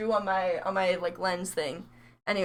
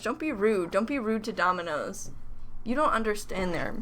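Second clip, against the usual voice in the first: ordinary talk, nothing marked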